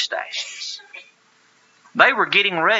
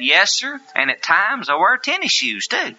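sarcastic; 6 semitones higher than usual. Retold or imitated. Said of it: "I very sarcastically said".

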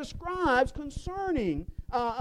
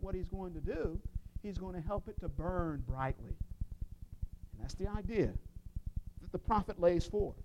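much softer and lower; quiet, intimate preaching; hushed sermon point; 6 semitones lower, 12 dB quieter than usual. Acted. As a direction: draw the congregation in quietly. Quiet point about gentle servant.

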